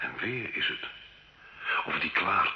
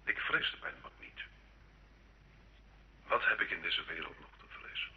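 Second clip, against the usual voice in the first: weary and quiet; 8 dB quieter than usual. Acted. Gilles answers the inquisitor, drained.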